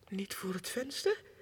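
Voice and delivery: ordinary delivery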